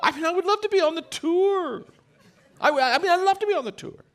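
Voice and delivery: high, eager wish; excited longing. Real, not acted. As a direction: lift the voice with the dream. Dreaming of golf tour.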